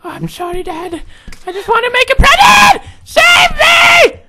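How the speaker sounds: put-on very high child voice building to shrieks; sorry son, then screaming. Son apologising to Dad then crying for rescue, building shriek. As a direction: start small and squeaky, end screaming.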